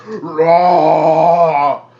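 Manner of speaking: long, full-force monster roar; loud roar. Acted. Game master voicing the roar heard through the cavern, loud roar. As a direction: let a huge roar ring out. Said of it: "this roar throughout the entire cavern".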